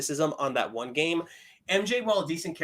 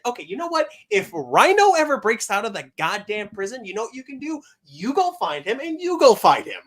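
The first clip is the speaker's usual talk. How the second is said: higher, louder, worked-up comeback; mock exasperated retort; 7 semitones higher, 4 dB louder than usual. Imagined Peter answering back to MJ, mock exasperated. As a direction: play the boyfriend who has had enough.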